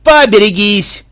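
loud, drawn-out 'stand clear' shout; long, high warning holler; barked warning. A narrator's reading. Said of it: "рявкнул Махмуд".

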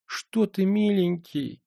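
high, wide-eyed crone voice; mock-innocent old woman asking back; surprised old woman. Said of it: "удивлённо уставилась".

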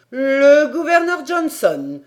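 loud, high, drawn-out outcry; exclamation. A narrator's reading. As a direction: cry out the name in disbelief, stretching it. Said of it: "s'écria Paganel". (exclamation forte et incrédule)

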